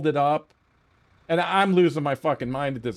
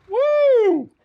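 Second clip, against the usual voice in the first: high, loud whoop of glee; excited whoop; 7 dB louder than usual. Real, not acted. Telling the story to viewers, a loud gleeful whoop. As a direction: let out a wild woo. Burst after laughing fit.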